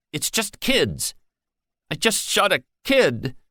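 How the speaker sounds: put-on guard realizing he shot a child; shaking voice. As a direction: voice trembling with guilt. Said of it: "His voice shook".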